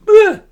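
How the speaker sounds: sudden high, loud noise of disgust; exasperated high-pitched scoffing groan; loud disgusted ugh. Real, not acted. Scoffs at the claim just quoted.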